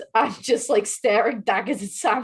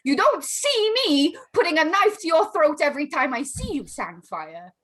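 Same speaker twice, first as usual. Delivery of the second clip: raised, loud in-character outburst; angry accusation; 6 semitones higher, 5 dB louder than usual. Acted. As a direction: hurl the blame in a heated quarrel. Acted quarrel between two characters.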